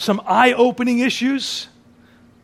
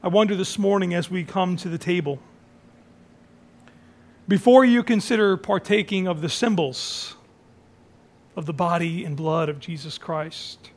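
normal talking voice

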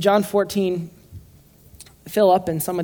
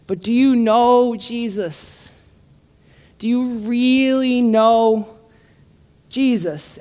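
higher, louder and slow; impassioned plea; 6 semitones higher, 5 dB louder, much slower than usual. Real, not acted. Preacher challenges the room to truly know Jesus, impassioned. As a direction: preach it, drawing out every word.

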